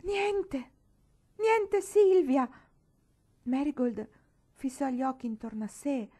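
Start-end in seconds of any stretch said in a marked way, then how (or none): 0.0-2.5 much higher and louder, slow, breaking on niente; anguished cry. A narrator's reading. Marigold realises Silvia is gone, anguished slow cry. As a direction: cry out the loss, drawing out niente. Marigold's fairy friend never comes.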